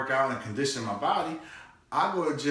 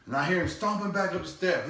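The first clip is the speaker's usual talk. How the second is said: normal talking voice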